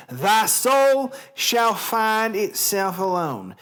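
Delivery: theatrical Southern reading, lingering on the words; Southern accent. Shown as a demonstration. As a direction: declaim it with a drawn-out Southern drawl. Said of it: "a Southern accent".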